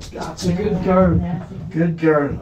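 slow, high pet-talk praise; fond dog-praise voice; praising the dog. Real, not acted. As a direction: coo at the dog slowly.

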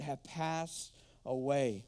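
slow, drawn-out solemn reading; slow solemn intoning. Acted. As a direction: stretch each word out. Preacher intones scripture phrase.

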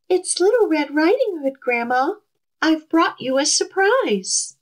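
wolf faking a high, sugary sweet voice; sweet as honey. A narrator's reading. Wolf at the door tricking Grandma, sweet as honey. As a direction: coo it sweet as honey to fool her. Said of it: "in a voice as sweet as honey".

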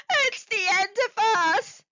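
high, loud tearful wail. Acted. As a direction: sob out the doom. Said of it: "Lobster wailed".

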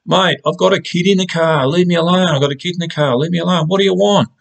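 high, desperate yell at the attacker; a panicked mother shouting high from a reversing car; yelling out the window. Retold or imitated. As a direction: scream it up high, begging him to back off. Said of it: "I'm yelling out the window".

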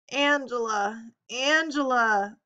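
slow, drawn-out plaintive moan. A narrator's reading. Sydelle calling for her partner from the stretcher, moaning. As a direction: stretch the name out, pleading. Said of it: "Seidel moaned".

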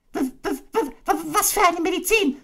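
very high squeaky stutter; a terrified little pig; stammering Piglet. Acted. Piglet dreading the medicine, stammering.

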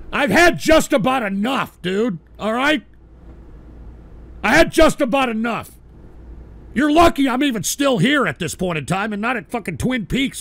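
loud, high, fed-up outburst; angry rant. Acted. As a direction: snap at the caller, fed up. Host snaps at rude caller.

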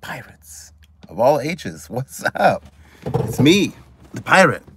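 high, drawn-out ringmaster greeting; big live-show opening, words spaced for effect; showman's announcer intro. Acted. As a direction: stretch out a grand welcome to the crowd.

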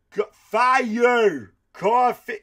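high, loud yell of coffee; imitated shout. Comedian mimicking a moviegoer shouting random words, loud yell. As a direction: holler it out of nowhere like the moviegoer. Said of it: "imitating the moviegoer".

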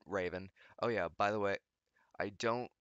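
ordinary talk

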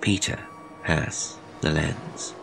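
soft, slow final words; grim quiet ending. A narrator's reading. Brother quietly ending his sister's hope with dread. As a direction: let it fall with dread.

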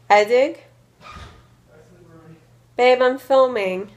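calling out. Real, not acted. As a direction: call out to your partner that you're busy.

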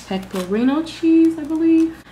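unsure name lifted high, left hanging like a question. Real, not acted. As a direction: say it high and tentative, checking you got it right.

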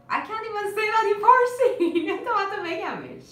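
high, loud, laughing protest; amused disbelief. Real, not acted. Teacher laughing at failing to say it in Farsi, amused disbelief. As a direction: break into laughter at your own tangled words. Trips over her own phrase, laughs.

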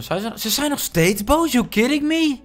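ordinary talk, nothing marked

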